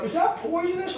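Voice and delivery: high, anxious question; nervous customer asking; worried onlooker. Retold or imitated. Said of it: "and they're like".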